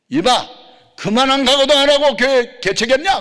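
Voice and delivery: loud, high outraged rebuke; angry scolding. Retold or imitated. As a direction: bellow it like a senior telling off a junior. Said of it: "막 그러면서 야단을 치더라고요". (버럭 소리치며 야단치는 말투)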